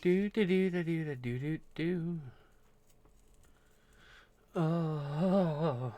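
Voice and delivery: strained humming through back pain; having a back spasm. Real, not acted. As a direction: hum while the back seizes up. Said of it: "that was a back spasm".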